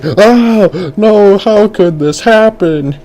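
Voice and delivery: high, drawn-out crowd wail; mock screaming panic. Crowd sees the elephant stampede, high mock scream. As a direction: cry out like a panicked onlooker. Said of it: "everyone was starting to scream".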